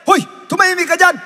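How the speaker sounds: quick, high, loud rebuke; shouted hushing. Acted. Someone in the crowd hushes Bartimeus, yelling. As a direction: cut in with a fast yell. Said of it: "nung pinigilan siya".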